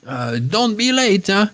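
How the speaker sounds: put-on old hag sending the girls off, demanding her pies. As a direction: brush them off like a bossy old hag. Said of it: "becomes this old hag again".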